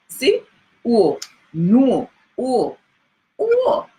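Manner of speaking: O swooping high and loud; Jamaican UO sound. Shown as a demonstration. Teacher showing students the Jamaican UO sound. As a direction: sing the UO vowel up high. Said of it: "I hope I turn it the right way".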